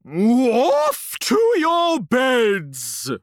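slow, stretched royal shout; shouting queen. Retold or imitated. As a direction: bellow the order slowly. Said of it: "and shout".

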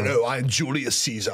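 put-on a light movie-Roman British accent; a touch of British accent for a film Caesar; mock British accent. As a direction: say it like a Brit in a Roman epic. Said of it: "But they're like".

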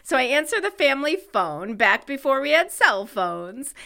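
ordinary talk, nothing marked

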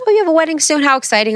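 high, gushing mock excitement; excited store clerk. A store clerk gushing to a bride about her wedding, excited. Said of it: "She's like".

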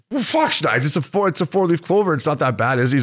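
high-pitched mock pleading; defensive protest. Defends tattoo in retold exchange.

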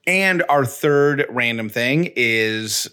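slow, drawn-out show announcer; segment announcement. Acted. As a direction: announce it slowly like a host.

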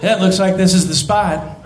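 ordinary talk, nothing marked

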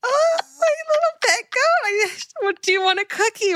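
mock baby talk. Mimicking the goat episode clip.

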